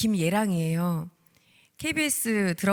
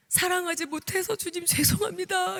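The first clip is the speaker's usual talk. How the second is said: crying prayer; 11 semitones higher than usual. Retold or imitated. Praying alone in the hospital chapel, sobbing. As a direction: pour out the apology through tears. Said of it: "이러면서 막 울면서".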